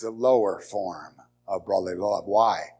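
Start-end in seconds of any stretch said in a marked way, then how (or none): none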